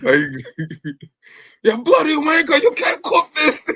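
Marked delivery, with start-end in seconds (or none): 1.6-3.7 hammed-up British accent, scolding a cook like Gordon Ramsay; Gordon Ramsay impression. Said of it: "produce Gordon Ramsay".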